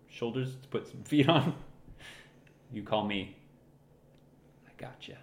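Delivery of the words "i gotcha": whispered. Pretend.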